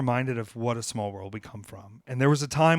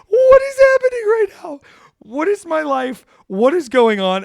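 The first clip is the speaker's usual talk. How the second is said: very high, loud, incredulous squeal; a high, loud, overwhelmed squeal of disbelief; shrieking disbelief; 11 dB louder than usual. Retold or imitated. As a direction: shriek it out like you can't believe your eyes. His own disbelief, retold.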